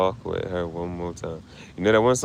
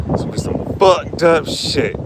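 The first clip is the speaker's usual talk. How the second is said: voice cracking; 8 semitones higher, 5 dB louder than usual. Real, not acted. Grieving, voice strains high.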